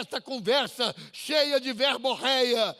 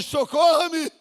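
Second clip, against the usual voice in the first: anguished cry; 6 semitones higher, 7 dB louder, slower than usual. Acted. Mother begs again after rebuff.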